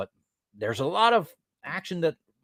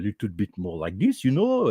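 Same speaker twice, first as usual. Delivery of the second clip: European French accent; 4 semitones lower than usual. Shown as a demonstration. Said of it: "that's more like the French from Europe".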